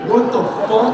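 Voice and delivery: loud, high shocked outburst; shocked Conan. Retold or imitated. Conan hearing about the period party, loud shocked outburst. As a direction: blurt it in disbelief.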